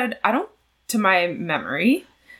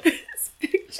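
laughing; 13 semitones higher than usual. Real, not acted. Showing a funny photo.

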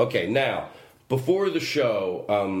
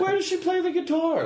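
much higher, laughing, desperate dodge; mock desperate question. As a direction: squeak it out, clutching for anything to say. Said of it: "I was so desperate".

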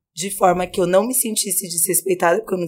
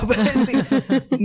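laughing; 5 semitones higher, much slower than usual. Real, not acted. Laughing while recounting.

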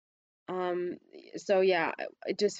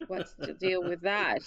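ordinary talk

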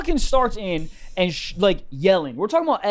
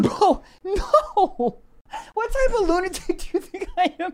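high, squeezed voice through laughter; real laughter pushing the voice high; laughing protest; 10 semitones higher than usual. Real, not acted. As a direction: deny it while cracking up. Laughing denial to teasing host.

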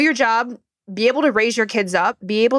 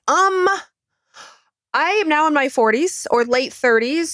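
hesitant patient; 7 semitones higher than usual. Retold or imitated. Women telling their doctor about symptoms, hesitant. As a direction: imitate a patient raising a worry in a higher voice.

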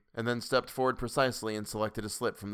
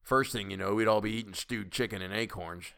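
put-on grumpy old man. A narrator's reading. Old man figure holds the quote.

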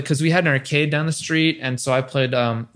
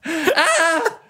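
mock scream; 13 semitones higher than usual.

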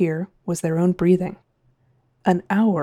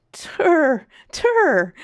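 laughing exhaustedly; 9 semitones higher, 3 dB louder than usual. A narrator's reading. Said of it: "The captain laughed exhaustedly".

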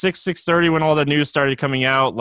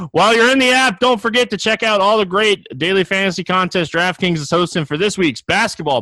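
upbeat ad read, pitched up; booming prize announcer; 4 semitones higher than usual. Acted. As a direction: sell it like a radio spot. Reads a sponsor ad.

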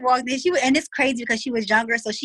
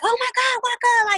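put-on much higher, thrilled child's squeal; imitated childish excitement, much higher; gushing little girl; 13 semitones higher than usual. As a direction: imitate a little kid thrilled to see dad.

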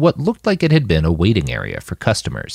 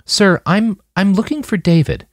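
much higher, halting nervous voice; voice catching; 12 semitones higher than usual. A narrator's reading. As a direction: stumble out the words, throat tight. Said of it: "Her voice caught in her throat".

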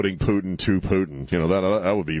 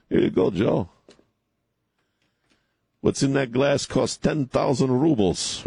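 put-on slow, lightly done Russian accent; slight Russian accent; 4 semitones higher than usual. Vlad serving Joe a drink, mock Russian accent. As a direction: put on a hint of Russian, stretched out. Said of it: "I can do a slight Russian accent".

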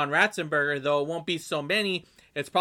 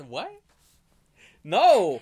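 loud protest; 5 semitones higher, 9 dB louder than usual. Real, not acted. Indignant outburst at the friend.

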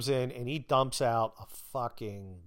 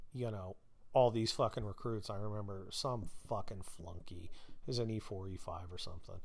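ordinary delivery

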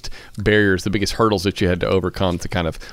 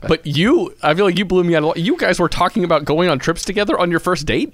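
much higher, astonished questioning; amazed disbelief; 9 semitones higher than usual. Real, not acted. Host reacting to Chad's trip talk on a first date with amazement.

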